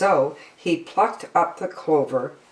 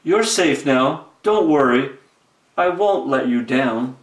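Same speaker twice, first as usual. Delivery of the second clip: lightly voiced elephant reassuring; calm elephant calling down; reassuring Horton; 2 semitones lower than usual. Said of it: "Horton called back".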